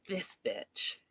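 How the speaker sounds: a private hiss of annoyance in her head; muttered inner thought. Retold or imitated. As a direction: say it to yourself, barely audible.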